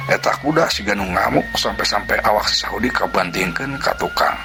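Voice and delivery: usual speaking voice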